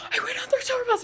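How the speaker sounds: very high excited squeal; losing her mind. Retold or imitated. Grabbing her friend to share the news, frantic squeal. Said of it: "losing my mind".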